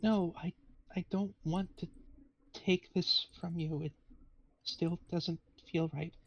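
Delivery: halting, timid in-character refusal; reluctant spirit. Acted. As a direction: plead softly, with hesitant pauses. GM voices the reluctant trapped spirit.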